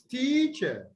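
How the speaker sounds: TEACHER with a high, drawn-out "tea"; teacher, lingering on "tea", short "cher"; long first syllable. Shown as a demonstration. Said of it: "so it is longer".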